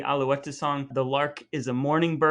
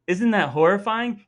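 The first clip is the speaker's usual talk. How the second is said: higher, louder mock-appalled question; mock horror; 6 semitones higher, 6 dB louder than usual. Real, not acted. Host mock-appalled at the song's lyrics. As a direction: sound scandalized for fun.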